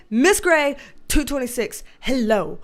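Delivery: normal talking voice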